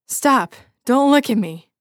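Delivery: loud, high, terrified plea; frightened cry. A narrator's reading. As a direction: beg in panic.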